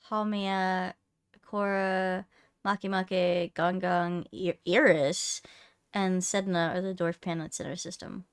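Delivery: ordinary talk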